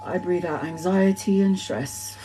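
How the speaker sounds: soft mindful breathing cue; guided breathing. Shown as a demonstration. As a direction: breathe the words out calmly, like leading a meditation. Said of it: "through mindful meditation".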